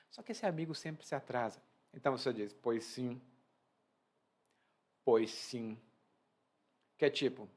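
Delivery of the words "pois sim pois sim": sceptical pois sim. Acted. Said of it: "tem uma entonação específica".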